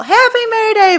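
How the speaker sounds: loud, very high happy holler; yelling back. Retold or imitated. Mother yelling back to the kids from her open door, high yell. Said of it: "I yell".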